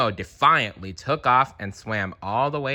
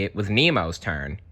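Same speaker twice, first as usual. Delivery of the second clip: usual speaking voice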